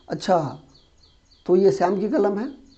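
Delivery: mildly surprised question, lifting after अच्छा; acted surprise. Child told by mother the pen is his brother's, surprised. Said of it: "आश्चर्य हुआ ना आपको".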